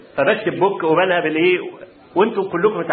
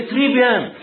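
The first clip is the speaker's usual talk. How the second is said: high, lilting French praise; a pleased teaching assistant's très bien; teacher praising; 9 semitones higher than usual. Retold or imitated. Assistant praising the student's reading: high French praise. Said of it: "يقول لي".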